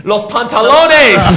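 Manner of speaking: a high, loud, slow yell from the crowd; shouted. Retold or imitated. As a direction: call out the answer, stretching it slowly. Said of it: "Y grita Deep Pity". (el público grita pidiendo los pantalones, agudo y lento)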